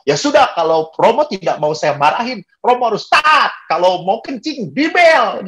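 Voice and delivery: high-pitched imitation of a stern doctor; mock scolding. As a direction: lay down the law, voice leaping up. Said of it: "dokternya tuh marah-marah terus".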